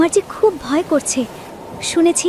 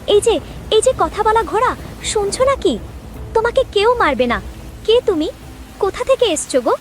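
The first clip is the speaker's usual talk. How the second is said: excited calling; 7 semitones higher than usual. Acted. Calling gently to the horse.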